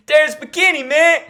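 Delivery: hype call. Acted. Hyping up the fighter's name.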